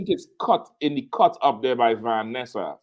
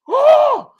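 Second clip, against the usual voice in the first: very loud, high mimicked gasp; deep breath; 10 dB louder than usual. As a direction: suck in air dramatically, imitating him. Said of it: "he took a deep breath".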